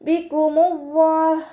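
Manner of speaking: slow, drawn-out melodic tajweed chant; Quran recitation. Shown as a demonstration. Teacher models melodic Quran recitation for students. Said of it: "അള്ളാഹ് എന്നുള്ള നാമത്തിൻ്റെ".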